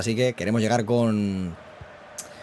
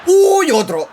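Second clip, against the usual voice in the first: high, loud yelp; a sudden high cry at the chance; startled; 10 dB louder, much slower than usual. Real, not acted. Reacting to another shot on goal, high startled yelp. Near miss during match.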